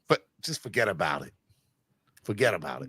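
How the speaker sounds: low, put-on New York accent. Host showing off a low New York accent. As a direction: toss it off in low New Yorkese. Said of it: "but the New York accent".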